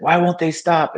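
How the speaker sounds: retelling a frantic man begging the ambulances to stop; retold desperate yell. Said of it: "he's like".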